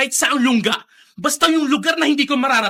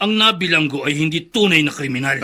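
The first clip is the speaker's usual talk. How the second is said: menacing low voice; 9 semitones lower than usual. Acted. Oswald waiting in the congressman's room, low and cold. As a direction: speak low and threatening.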